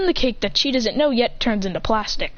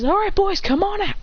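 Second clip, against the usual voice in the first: higher rallying call; calling clones; 9 semitones higher than usual. Acted. Boy calls out his clones, higher call. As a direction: call the boys out.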